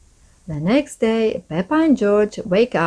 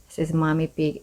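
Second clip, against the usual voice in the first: ordinary delivery, nothing marked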